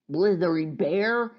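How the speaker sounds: mild storybook exclamation; exclaiming. A narrator's reading. Euphonia to the bear refusing rescue, exclaiming. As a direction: exclaim it with a touch of scolding. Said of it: "exclaimed Euphonia".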